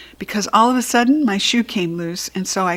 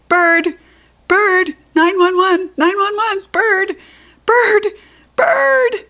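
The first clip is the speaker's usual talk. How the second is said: panicked screech, much higher and louder; 12 semitones higher, 6 dB louder, slower than usual. Acted. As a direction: shriek for help at the top of your lungs. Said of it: "I screeched".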